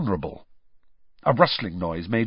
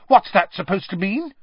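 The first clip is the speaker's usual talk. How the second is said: high, louder defiant challenge; 9 semitones higher, 5 dB louder than usual. A narrator's reading. Hermione challenges Malfoy in the woods, defiant. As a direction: throw the question back defiantly. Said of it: "said Hermione defiantly".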